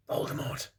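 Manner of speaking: unvoiced hushed whisper; whispered dread name. A narrator's reading. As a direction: breathe the name in fear. Everyone gasps, hushed name.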